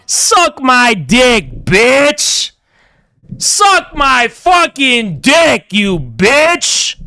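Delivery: slow, drawn-out shouted insult; angry shout. Real, not acted. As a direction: bellow it slowly at them. Angry rant at critics.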